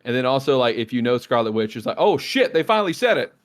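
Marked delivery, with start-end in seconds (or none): 2.0-3.3 excited fan. Retold or imitated. Voicing excited comics fan.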